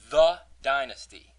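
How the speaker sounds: "the Dynasty" with THE punched loud; stress on THE. Shown as a demonstration. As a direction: hit "the" hard before Dynasty. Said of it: "It's not just Dynasty".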